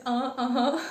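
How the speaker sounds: mock hemming and hawing; imitated hesitation fillers. Speaker shows viewers her stalling while translating, imitated hesitation. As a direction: mimic your own stalling fillers. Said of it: "щоб не було так багато цих".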